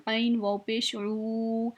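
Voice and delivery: ऊ in आइन वाव पेश ऊ held long; stretched vowel. Shown as a demonstration. Teacher showing students the long ऊ, slowly. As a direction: draw out the ऊ a full alif's length. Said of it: "इसको भी हम खींच के पढ़ेंगे".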